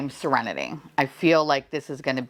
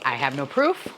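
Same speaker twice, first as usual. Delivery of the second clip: normal talking voice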